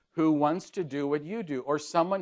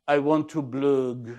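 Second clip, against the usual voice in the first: put-on French accent; 3 dB louder than usual. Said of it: "he's French, very French".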